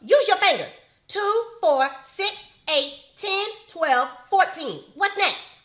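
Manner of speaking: loud, high counting at him; impatient scolding. Acted. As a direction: count it out, fed up.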